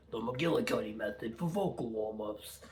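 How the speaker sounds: playful put-on McGillicuddy accent, R's dropped; jokey, badly faked McGillicuddy accent; mock McGillicuddy accent.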